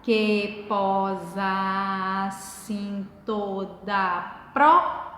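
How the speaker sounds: very slow, level syllable count, jumping up on the last syllable; escansão silabada. Shown as a demonstration. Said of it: "Então, nesse caso aqui, eu tenho". (sílaba por sílaba, parando forte no "pró")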